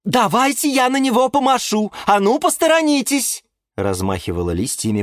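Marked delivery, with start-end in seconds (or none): 0.0-3.4 very high, eager character voice; bustling palm tree. A narrator's reading. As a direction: push through the crowd, high-pitched and bossy. Eager palm pushes through the crowd. (высокий суетливый голос пальмы)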